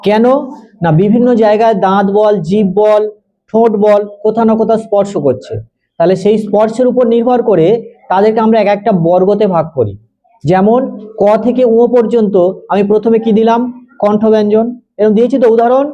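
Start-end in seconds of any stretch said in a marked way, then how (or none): none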